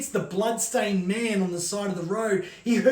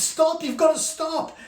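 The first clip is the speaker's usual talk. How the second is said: screaming; 8 semitones higher than usual. Acted. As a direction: scream at the driver to stop the truck. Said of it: "She screams".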